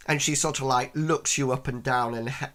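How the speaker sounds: ordinary talk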